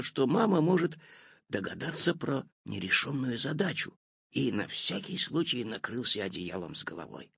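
plain reading voice

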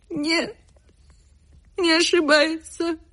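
high, wailing servant woman; grieving maid, voice pitched high and keening; lamenting old maid. Acted. Maid's lament. (горничная причитает высоким голосом)

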